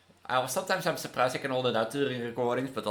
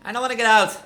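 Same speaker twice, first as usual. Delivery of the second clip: yelled in protest; loud, high shout; 10 semitones higher, 11 dB louder, faster than usual. Real, not acted. Shouting at game character.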